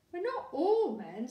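put-on a little girl correcting her dad; Laura. A narrator's reading. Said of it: "said Laura".

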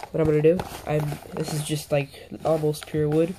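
usual speaking voice

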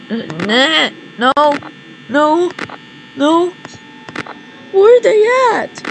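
rising panicked no no no; climbing panic, ending in a yelp. Real, not acted. Panic rising as Bonnie vanishes.

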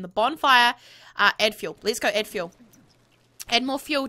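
ordinary talk, nothing marked

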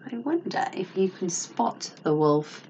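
plain reading voice

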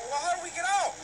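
panicked high plea; panicking to escape; scared horror game streamer. Real, not acted.

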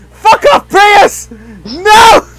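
high, loud yells; angry protest shout. Real, not acted. Player fending off Prius, protesting shout. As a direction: shout them off you.

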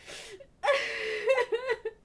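high tearful laugh; real laugh-sob, overwhelmed; sobbing laugh. Real, not acted. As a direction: laugh while crying for joy. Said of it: "I'm actually crying".

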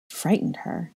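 soft breathy whisper of "Frightened her"; whispered echo. A narrator's reading. Narrator echoes her fear in a whisper. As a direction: let the words fall to a hush.

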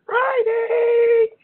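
high, loud drawn-out cheer; slow, ringing Friday whoop gone wrong; botched excited shout. Real, not acted. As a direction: belt out the day in a long high holler. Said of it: "I know that came out all wrong".

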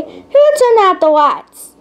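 slow, high Southern scolding call; yelling Southern mom. A narrator's reading. Mom asks who killed the lights, Southern yell. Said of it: "his mother yelled from the hallway".

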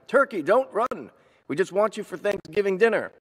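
high, urgent coaxing call; calling after someone. A narrator's reading. Mister Moose chasing fleeing Turkey, calling after him. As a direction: call out high to stop a runaway.

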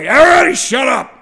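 loud, high commanding yell; shout. Retold or imitated. Boss silences an angry township meeting with a loud shout. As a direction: bark it at a noisy crowd.

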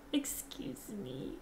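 soft slow whispered excuse me. Real, not acted. Apologizing to the viewers, whispered.

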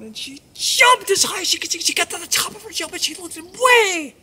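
high, loud leap of the voice; excited storytelling burst. A narrator's reading. Storyteller as the mouse jumps high, excited burst. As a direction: soar up loudly with the jump.

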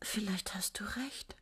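soft slow breathy whisper; hushed and slow, just for him. Acted. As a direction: whisper it close to his ear. Intimate talk with imagined Gottfried.

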